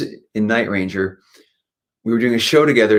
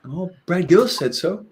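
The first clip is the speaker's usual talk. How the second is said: mock awed; 9 semitones higher than usual. Real, not acted. Mocking his own reaction.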